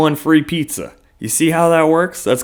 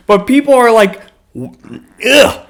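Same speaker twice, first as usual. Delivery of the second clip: mock disgusted groan; 10 semitones higher, 6 dB louder than usual. People recoiling from coupon guy.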